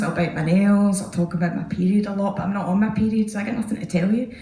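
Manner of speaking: ordinary talk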